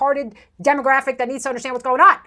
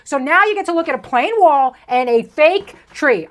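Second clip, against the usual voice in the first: ordinary talk, nothing marked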